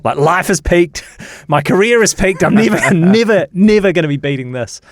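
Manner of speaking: high, emphatic amazement with a laugh; overawed exclamation. Retold or imitated. Journalist thinking his career has peaked, giddy and overawed.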